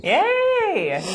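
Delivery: high loud cheer; excited cheer. Real, not acted. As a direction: cheer it out big. Cheering celebration.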